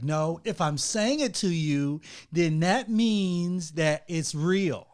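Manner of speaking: slow, drawn-out mock insistence; imitating wife's slow earnest tone. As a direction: drag it out like lecturing.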